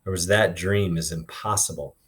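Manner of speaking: usual speaking voice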